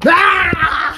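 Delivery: sudden high shriek of shock; real scream of fright. Real, not acted. Startled by someone behind door. (grito real de susto)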